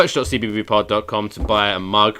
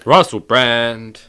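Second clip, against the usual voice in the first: calling out; 3 semitones higher, 9 dB louder, much slower than usual. Pretend. Calls out to absent celebrity.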